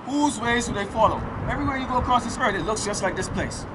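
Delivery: ordinary talk, nothing marked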